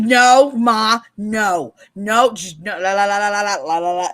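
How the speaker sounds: loud la la la to block out her mother; loud drowning-out. Retold or imitated. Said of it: "I was like".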